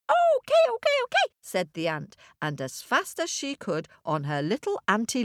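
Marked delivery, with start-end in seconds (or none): 0.1-1.2 very high squeak; squeaky ant. Acted. Ant agrees.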